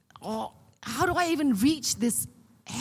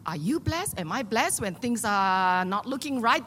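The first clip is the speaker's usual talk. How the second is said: normal talking voice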